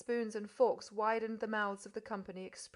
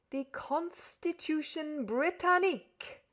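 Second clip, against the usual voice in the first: slow, higher and very clear; teaching an infant school; 7 semitones higher, slower than usual. A narrator's reading. Podsnap explaining to the foreigner like to an infant class. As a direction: spell it out like to small children. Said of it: "as if he were teaching in an infant school".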